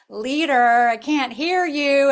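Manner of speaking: put-on loud, brash New York accent heckle. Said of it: "in a New York accent".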